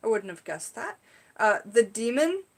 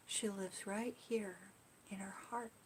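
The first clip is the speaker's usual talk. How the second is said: put-on soft child's words; 15 dB quieter than usual. Niece comforting her in car.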